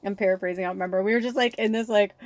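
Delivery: ordinary delivery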